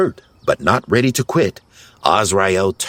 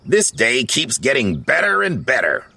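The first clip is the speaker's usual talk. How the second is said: higher-pitched grumbling whine; whining; 5 semitones higher than usual. A narrator's reading. Grouchy complains about the day, whining. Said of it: "Grouchy whined".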